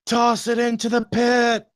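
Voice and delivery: high, drawn-out heroic cry; dramatic urging. Acted. Player urging friend to destroy the horn, high dramatic cry. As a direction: urge it like an epic film climax.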